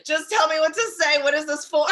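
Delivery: high, loud comic begging; mock desperate plea. Host jokingly begging spirit for the tarot message, mock desperate plea. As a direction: plead upward in playful exasperation.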